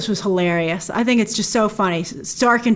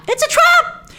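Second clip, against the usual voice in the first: much higher, hammed-up alien alarm cry; mock alarm cry; slower than usual. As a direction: shriek the warning like a cartoon admiral. Repeats the famous line.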